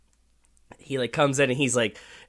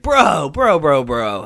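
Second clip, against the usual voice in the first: bro voice; 2 semitones higher, 7 dB louder, slower than usual. Retold or imitated. Imitating a guy who says bro, loud and eager. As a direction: bellow bro at a friend walking up.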